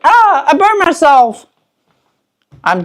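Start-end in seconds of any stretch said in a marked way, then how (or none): 0.0-1.5 mock yelp. Mock reaction to a splash burn.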